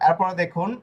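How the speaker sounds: ordinary delivery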